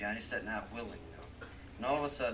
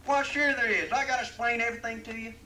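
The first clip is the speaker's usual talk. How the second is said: much higher, louder punchline, cracking up; triumphant cowboy; 12 semitones higher, 11 dB louder than usual. Acted. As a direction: deliver the punchline laughing at his own joke. Cowboy explains punchline, laughing.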